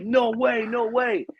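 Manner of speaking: high, incredulous no way; stunned disbelief. Retold or imitated. The boss hearing the story, high and stunned. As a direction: gasp it out in disbelief. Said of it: "the boss is like".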